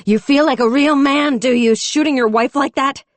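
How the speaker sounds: high, loud scornful yelling at the shooter; furious taunt. A narrator's reading. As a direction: tear into the wife-beater with contempt. Screaming at the shooter.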